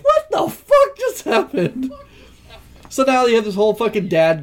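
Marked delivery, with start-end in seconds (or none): 0.0-1.9 high, slow, laughing what-the-fuck; laughing disbelief. Real, not acted. Fan venting to a friend about the plot, laughing high disbelief. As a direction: laugh at the absurd twist. Ranting at a movie's ending.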